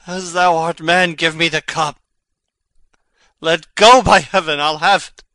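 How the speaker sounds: wrest the cup away by force; urgent shout. Acted.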